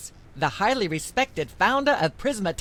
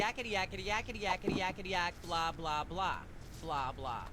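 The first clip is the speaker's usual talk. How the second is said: background chatter; 2 semitones lower, 10 dB quieter than usual. Acted. Myra drones on beneath talk.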